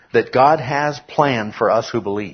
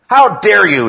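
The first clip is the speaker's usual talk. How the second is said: high, loud indignant cry; outraged exclamation, pitched high; emphatic rebuke; 10 semitones higher, 9 dB louder than usual. Acted. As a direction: throw it out as a raised-voice challenge. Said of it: "he puts it".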